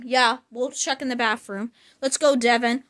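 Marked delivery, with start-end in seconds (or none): none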